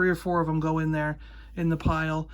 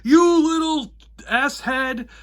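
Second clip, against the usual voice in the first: furious threat; 7 semitones higher, 6 dB louder than usual. Retold or imitated. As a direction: hurl the insult and break off mid-threat. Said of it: "and he goes".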